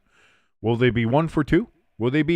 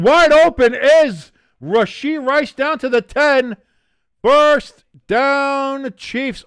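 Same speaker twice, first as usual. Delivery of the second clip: high, loud play call; excited call; 10 semitones higher, 6 dB louder, slower than usual. Real, not acted. Streamer calling the Chiefs gain, loud and high. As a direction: yell the big gain down the field.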